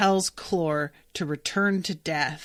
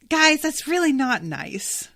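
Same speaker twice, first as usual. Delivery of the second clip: normal talking voice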